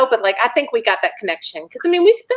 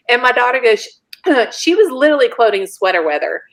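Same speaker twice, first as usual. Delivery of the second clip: usual speaking voice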